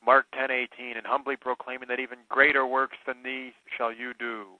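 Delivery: normal talking voice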